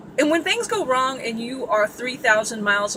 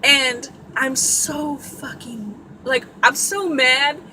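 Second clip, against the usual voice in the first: normal talking voice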